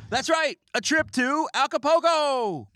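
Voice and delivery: high, loud grand prize call; game show announcer. Acted. Joking prize reveal to his viewers, booming announcer. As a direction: announce the vacation like a TV host.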